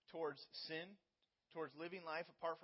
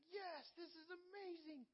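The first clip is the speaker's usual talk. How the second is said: high, slow, tearful gasp of joy; breathless wonder at a newborn, much higher and drawn out; overwhelmed joy; 13 semitones higher, 8 dB quieter than usual. Retold or imitated. New parent meeting their baby, in overjoyed awe. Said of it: "you're just emotionally like".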